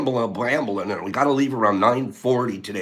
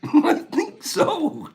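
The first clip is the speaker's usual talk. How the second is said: high, drawn-out laughing read-out; much slower than usual. Real, not acted. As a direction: read it out slowly through high-pitched laughter. Cracks up reading a chat reply.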